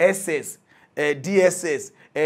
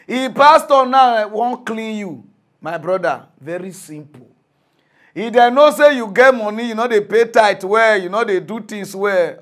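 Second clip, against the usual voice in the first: loud warning; 4 semitones higher, 9 dB louder than usual. Real, not acted. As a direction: warn them hard. Heated warning about pastors.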